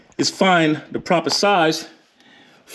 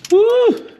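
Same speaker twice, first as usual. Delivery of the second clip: whoop; 5 dB louder than usual. Real, not acted. Bolt finally breaks loose.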